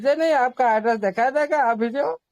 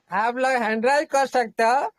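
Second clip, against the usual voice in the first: normal talking voice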